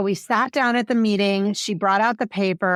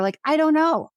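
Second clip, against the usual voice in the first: mom's panic peaking, voice jumps higher; panicked; 7 semitones higher than usual. Retold or imitated. Advocate imitates the panicked mom before high school, voice jumping higher. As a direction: imitate a panicked mom throwing up her hands. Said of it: "she was so panicked".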